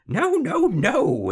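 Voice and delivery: very high, loud cackly glee; gleeful refusal. A narrator's reading. Little man dancing round the room, gleeful. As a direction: crow in triumph at the wrong guess. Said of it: "he laughed and danced".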